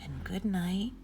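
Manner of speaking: gentle sing-song bedtime read-aloud; reading to children. Acted. Reads bedtime story to children.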